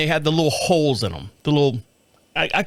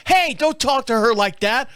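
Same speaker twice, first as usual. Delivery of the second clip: higher-pitched scolding as the wife; mock wife scolding; 7 semitones higher than usual. Husband imitating his wife's higher-pitched scolding. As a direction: mimic the wife telling him off. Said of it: "if my wife's in the room".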